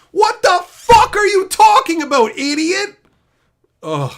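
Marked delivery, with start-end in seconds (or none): 0.1-3.0 high, loud outraged yell; furious yell. Real, not acted. Yelling at a Reddit commenter, furious high shout. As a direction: bellow it at the idiot.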